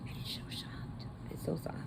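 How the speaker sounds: hushed, almost breathless whisper; soft whisper. Real, not acted. Whispering to viewers about how soft the yarn is.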